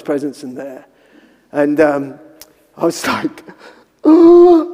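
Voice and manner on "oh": high, loud, drawn-out comic oh; nervous mock "oh". Preacher imitates his nervous self awaiting prayer, high squeak. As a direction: let out a high held oh. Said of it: "you know, like this".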